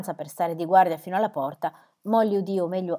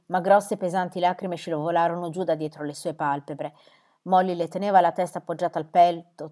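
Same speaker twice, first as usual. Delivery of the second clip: plain reading voice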